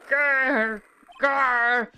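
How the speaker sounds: loud mock tiger growl. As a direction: growl at them like a tiger about to pounce. Tiger keeps coming.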